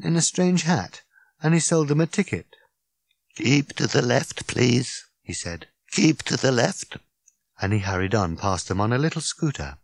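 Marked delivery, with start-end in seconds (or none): 3.4-5.0 lightly voiced little ticket man; a hint of an officious attendant; ticket seller. Attendant calls out to walkers. 5.9-7.0 lightly voiced little ticket man; a hint of an officious attendant; ticket seller. Attendant calls out to walkers.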